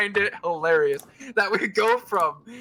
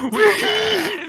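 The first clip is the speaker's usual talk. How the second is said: sobbing laughter; 4 dB louder than usual. Real, not acted. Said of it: "Breathe, buddy".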